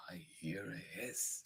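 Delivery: suspenseful storybook whisper; very soft, voiceless hush. Acted. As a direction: whisper it as if listening out. Echoes the hiss line in suspense.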